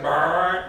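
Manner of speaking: loud, slow imitated engine brrr; engine noise. Racing to the dig site in his truck, loud engine brrr. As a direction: rumble like a truck taking off.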